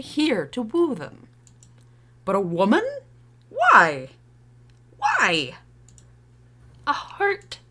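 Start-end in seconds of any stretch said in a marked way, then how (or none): 2.2-7.6 loud, exasperated outburst. Acted. Parent scolding child for confiding in a woman, exasperated. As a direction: punch out each question in disbelief.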